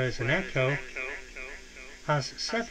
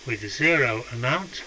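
usual speaking voice

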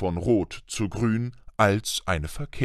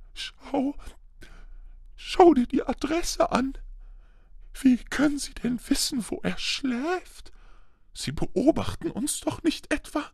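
high, halting frightened voice; trembling voice; 4 dB quieter than usual. A narrator's reading. Aunt fearing they are being watched, trembling to husband. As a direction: falter through it in a high tremble. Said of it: "mit zitternder Stimme".

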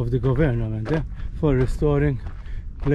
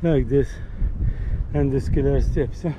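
breathless on the stairs; puffing words between breaths. Real, not acted. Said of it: "I'm out of breath".

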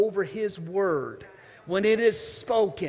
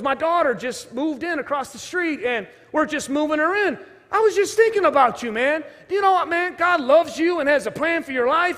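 higher, louder, fired-up friendly pastor; eager pastor greeting; 7 semitones higher, 5 dB louder than usual. Retold or imitated. Pastor bumping into a wayward kid on the street, eager and loud. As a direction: call it out like an excited pastor who spots an old friend.

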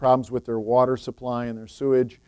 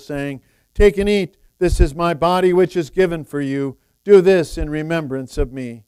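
solemn liturgy; 4 semitones higher, 8 dB louder than usual. Acted. Words of institution at communion.

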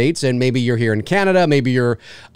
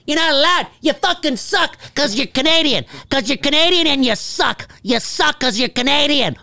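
high-pitched taunting voice; mock jeering; 11 semitones higher than usual. Streaming service jeering at Canadians, mock taunting. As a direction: taunt like a playground bully, put-on and high. Mimics those barring Canadians from Peacock.

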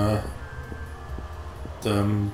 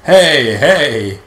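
mock loud hey; 9 dB louder than usual. Mocking the guard's hey.

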